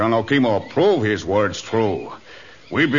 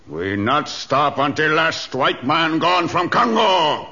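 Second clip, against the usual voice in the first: rousing war speech; 3 semitones higher, slower than usual. Acted. Chief vowing to his warriors to fight on, rousing build. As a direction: pitch climbing to a raised, ringing finish.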